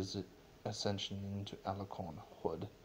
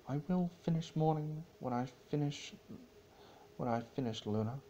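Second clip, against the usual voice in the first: Celestia's stubborn reply; 7 semitones higher, faster than usual. Acted. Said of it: "whispered Celestia".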